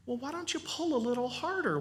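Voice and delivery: gentle stranger. Acted. Stranger voiced in story.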